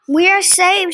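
mild shout of relief. A narrator's reading. Rat finds a door in the snow, mildly shouted. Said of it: "shouted Rat".

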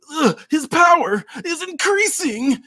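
high, slow dramatic anime voice; anime sidekick. Acted. Wrestler watching rival power up, slow dramatic anime voice. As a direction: gasp it out like an awed anime sidekick. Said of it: "Xavier Woods says".

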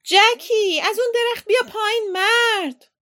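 high, loud, scolding yell; shouting. A narrator's reading. Aunt ordering Jackie down from the tree, shouting. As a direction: holler at someone far up a tree. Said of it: "فریاد زد".

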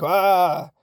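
startled scream. Retold or imitated. Parents see girl smacked.

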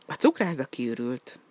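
usual speaking voice

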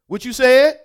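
high, loud butting-in question; nosy passerby. Acted. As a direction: pipe up as an eavesdropper.

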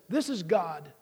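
calm low divine reply, softening at the end; voice of God. Acted. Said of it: "And the voice says".

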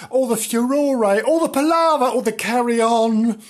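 high, loud comic flourish; hammed-up fuss. A narrator's reading. Storyteller listing the children's fuss, exaggerated. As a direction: build the list in mock commotion. Narrator hams up the commotion.

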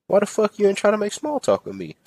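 high-pitched, incredulous demand; squeaky pretend indignation; mock outrage. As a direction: protest in comic disbelief. Mock outrage at missing small talk.

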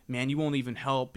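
normal talking voice

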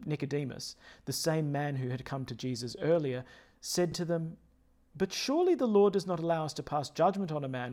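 formal lector reading; gospel reading. Acted. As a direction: proclaim the Gospel. Proclaims the Gospel.

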